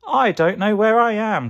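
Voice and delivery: high, loud lost cry; acted high call of alarm. A narrator's reading. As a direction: call out for help, pitched high. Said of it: "called Carrot".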